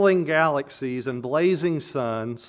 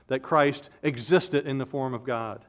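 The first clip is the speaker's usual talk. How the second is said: ordinary delivery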